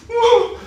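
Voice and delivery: very high, loud imitated wail; mock baby cry. Krishna startled by the cat, mock crying. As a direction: burst into a pretend baby wail. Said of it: "and, like, starts crying".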